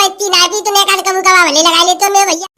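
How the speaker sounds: normal talking voice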